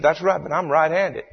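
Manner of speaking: high, insisting mock-Cajun voice; Cajun storyteller. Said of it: "tells the story in his hilarious Cajun accent".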